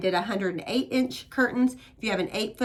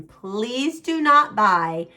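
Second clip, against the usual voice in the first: slow and louder, punching not; emphatic plea; 7 dB louder, much slower than usual. Real, not acted. Designer begging viewers not to buy matching pieces, slow emphatic plea. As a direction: plead firmly, every word spaced.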